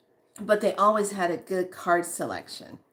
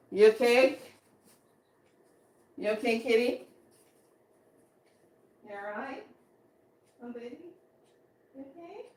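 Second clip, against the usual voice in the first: tender; 2 semitones higher than usual. Real, not acted. Checking on the cat.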